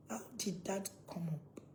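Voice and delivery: normal talking voice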